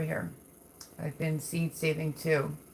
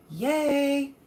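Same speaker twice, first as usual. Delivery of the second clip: high, bright squeal of joy; excited cheer; 9 semitones higher, 4 dB louder than usual. Acted. Cheering a viewer's seed saving.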